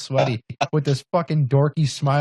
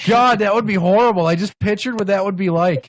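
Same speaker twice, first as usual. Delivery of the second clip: loud, high-pitched disgust; real horrified outburst; 5 semitones higher, 4 dB louder than usual. Real, not acted. Friend picturing the lovemaking, loud horrified reaction. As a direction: recoil aloud from an awful mental image.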